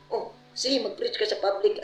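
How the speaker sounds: high-pitched voice granting permission; imitated leader. Leader letting a brother preach in public, imitated high voice. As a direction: voice a leader's agreement, pitched well up. Leader's reply in retold dialogue.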